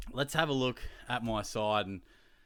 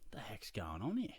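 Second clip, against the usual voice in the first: breathy whispered inner thought; whispered aside; 7 dB quieter than usual. Retold or imitated. Telling viewers his thought at the bar, whispered. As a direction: hush it like a secret thought to yourself. Retold private reaction to flirting.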